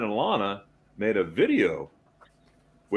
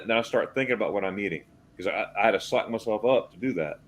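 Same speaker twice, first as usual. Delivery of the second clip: ordinary delivery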